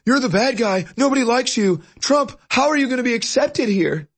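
high sneering taunt; mock the reporters in a high voice; high mocking voice taunting Trump; mocking media voice. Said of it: "they're saying".

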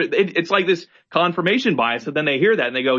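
ordinary talk

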